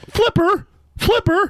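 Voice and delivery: very high teasing Flipper call; high mock squeal. Co-host teasing man about kicked thumb, high mock squeal.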